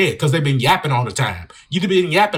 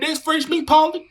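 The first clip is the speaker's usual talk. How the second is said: hammed-up hungry coyote; 11 semitones higher than usual. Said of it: "I'm like".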